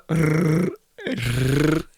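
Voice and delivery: slow trill on "rrr"; trilled "rrr" in a steady buzz; rolled R. Shown as a demonstration. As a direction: roll the tongue, long "rrr". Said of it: "Can you roll your R's?".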